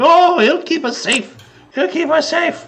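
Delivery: very high, squeaky goblin voice; fleeing goblins. Acted. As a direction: squeal with relief towards a protector. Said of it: "they just hightail it over to Falco".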